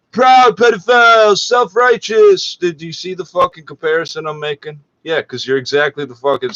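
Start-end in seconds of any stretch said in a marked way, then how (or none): 0.1-2.6 louder and higher, slow spat-out insults; furious yell. Real, not acted. Host insulting the radio anchors, loud furious yell. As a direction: hurl the words like an accusation.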